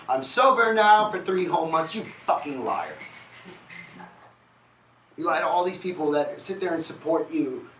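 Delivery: high, loud tearing into him; furious wife. Retold or imitated. Wife calling her husband a liar, high and furious. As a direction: spit the accusations up high and loud. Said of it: "she said".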